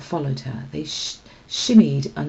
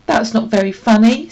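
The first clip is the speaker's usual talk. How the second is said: indignant; 6 semitones higher, 9 dB louder than usual. Acted. Tam indignant after the prank.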